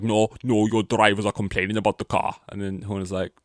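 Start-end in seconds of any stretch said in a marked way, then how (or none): 0.0-2.4 louder, insistent mock argument; heated retort. Toto arguing with Horner about the car, loud heated retort. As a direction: push back hard, imitating an angry boss. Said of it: "And he's like".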